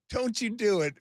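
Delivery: high, playful begging; teasing plea. Real, not acted. To his tearing-up partner, a high teasing plea. Partner about to get emotional.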